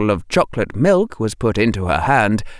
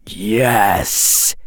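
hissed. A narrator's reading. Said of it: "hissed the snake".

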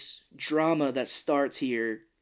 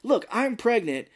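high, louder, emphatic protest; acted insistence; 8 semitones higher, 5 dB louder than usual. Pregnant woman correcting her husband, exasperated insistence.

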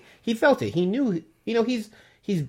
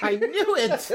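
laughing gloat; 9 semitones higher, slower than usual. Retold or imitated. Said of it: "And I'm like".

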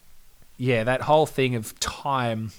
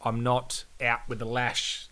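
ordinary talk

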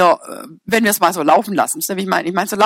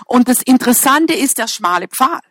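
ordinary delivery

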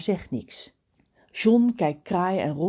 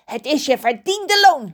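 higher, louder gloating crow voice; 8 semitones higher, 9 dB louder than usual. Acted. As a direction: crow it out smugly. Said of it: "lacht Kraai". (spottend en triomfantelijk)

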